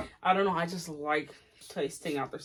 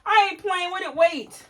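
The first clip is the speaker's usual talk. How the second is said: high, loud whiny denial; high whiny protest; 13 semitones higher, 7 dB louder than usual. Real, not acted. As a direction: protest in a wail. Denies playing, upset.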